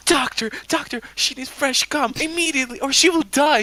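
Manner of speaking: high-pitched put-on urgency; mock frantic nurse. Imagined nurse urging the doctor, high mock panic. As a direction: plead in a squeaky high voice.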